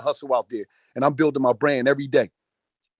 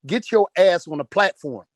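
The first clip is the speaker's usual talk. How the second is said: normal talking voice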